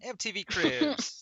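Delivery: high, slow, showy TV host. Pretend. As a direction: announce it like a TV presenter. Repeats as TV announcer.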